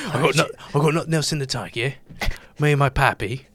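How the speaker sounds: badly faked British patter; bad British accent. Co-host with nothing left, still in his bad British accent. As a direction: ham up a rough British accent. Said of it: "do a British accent".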